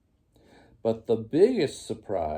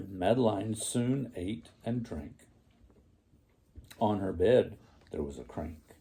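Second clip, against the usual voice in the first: ordinary delivery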